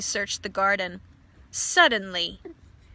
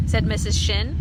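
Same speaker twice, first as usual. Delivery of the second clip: ordinary delivery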